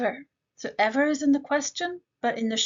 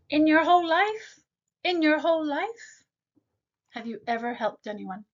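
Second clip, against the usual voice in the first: whole raised high, pressing; emphatic whole life; 5 semitones higher than usual. Shown as a demonstration. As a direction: push hard on whole. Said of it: "he really is emphasizing".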